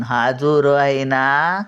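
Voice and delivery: ordinary delivery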